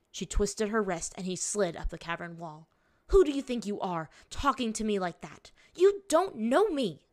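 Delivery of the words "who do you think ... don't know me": raised, louder, affronted anger; indignant rebuke. A narrator's reading. Captor rebuking her captive, indignant. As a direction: put him in his place angrily.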